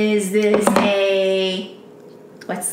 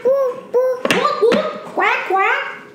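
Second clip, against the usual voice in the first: higher playful whoops and quacks; squeaky duck noises; 12 semitones higher, much slower than usual. Acted. Mom plays duck with toddler, higher squeaky noises. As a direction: squeak it out like a bath toy.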